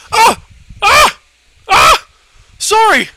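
high, loud startled shrieks; panicked screaming. Real, not acted. Panicked screams at game level.

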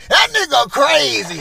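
a loud, high imitation of an ex calling him crazy; mock exclaimed verdict. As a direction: blurt it out like she's calling you crazy. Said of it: "That's what you gonna say".

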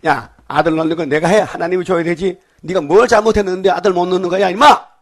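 loud angry telling-off, rising to a shout; scolding. Retold or imitated. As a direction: scold him like an angry father. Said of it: "막 야단치는 거예요".

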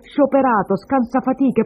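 slow, loud, high-pitched scolding; angry accusation. Acted. Woman insists the child is his, angrily scolding. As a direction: hammer out each accusation and insult. Woman hurls insults at man.

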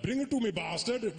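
pushy hammed-up Indian accent; overdone Indian accent. Shown as a demonstration. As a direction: exaggerate the Indian accent into a demand. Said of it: "don't take it too far".

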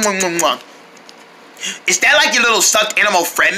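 normal talking voice

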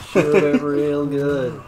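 loud, slow drawn-out voice; drawled quote voice. Pretend.

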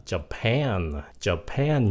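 JAPAN slowly, as ja-PAN; stress on second syllable. Shown as a demonstration. Teacher gives an example, slow ja-PAN, second syllable stressed. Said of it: "nhấn âm hai".

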